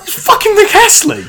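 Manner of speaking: high, loud incredulous yell; exasperated outburst. Retold or imitated. Narrator to oblivious mates after the cab ride, incredulous yell. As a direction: blurt it out loud, pitched right up.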